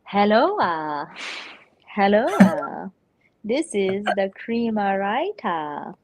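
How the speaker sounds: slow, drawn-out put-on greeting; exaggerated mimicry of a streamer's introduction; Cream Writer impression. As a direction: stretch the syllables with swooping endings. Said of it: "Prima Rita".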